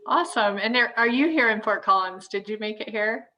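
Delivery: ordinary talk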